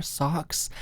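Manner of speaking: ordinary delivery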